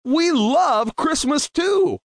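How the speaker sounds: high, slow, excited chorus of trees; a chorus of thrilled little trees, high and drawn out; excited cry. Acted. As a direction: cry it out with joy as the eager trees. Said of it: "They cried".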